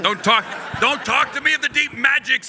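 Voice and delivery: high, loud fantasy proclamation; hammed-up high outrage; mock-epic scolding. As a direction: declaim it like an indignant ancient veteran. Mock fantasy veteran scolding, playing along.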